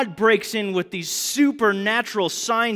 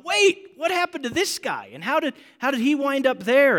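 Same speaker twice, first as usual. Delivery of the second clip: much higher, flustered questions; mock freaking out; 8 semitones higher than usual. Mom lost in the movie, freaking out, high and flustered. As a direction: play mom panicking over the plot. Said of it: "she's freaking out going".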